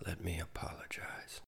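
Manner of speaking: soft whispered apology. Acted. Poet apologizing to the listener, whispered.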